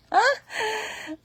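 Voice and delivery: loud squealing laugh; high burst of laughter. Real, not acted. Vlogger laughing at her own joke, loud squeal.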